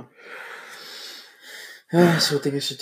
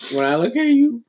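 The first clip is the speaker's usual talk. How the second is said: high, swooping mock flirtation; mock pickup line; 9 semitones higher, 4 dB louder than usual. Friend topping the last pickup line, mock flirty. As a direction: swoop up high like a corny come-on.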